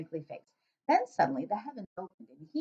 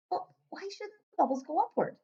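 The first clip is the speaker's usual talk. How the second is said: put-on higher, curious young girl; little girl voice; 10 semitones higher than usual. Sophie asking the giant, light girl voice. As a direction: ask like a puzzled small girl.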